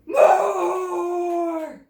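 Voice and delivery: huge, loud roaring monster voice; dinosaur roar. A narrator's reading. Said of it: "thunders Grizzle".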